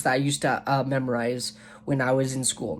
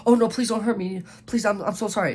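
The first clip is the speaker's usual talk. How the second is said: mock terrified plea, much higher; hammed-up cowering begging for mercy; trembling fear; 7 semitones higher than usual. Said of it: "It's not like that".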